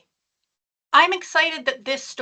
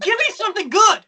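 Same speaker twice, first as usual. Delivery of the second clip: high, loud laughing protest; mock fed-up horror fan; 10 semitones higher, 5 dB louder than usual. Author imitating horror fans tired of Christmas, high laughing protest. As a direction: play a horror fan sick of Christmas cheer. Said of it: "They're like".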